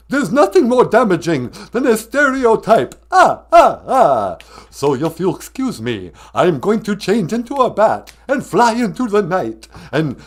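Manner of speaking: the Count leaving to drink blood. Acted. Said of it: "the Count".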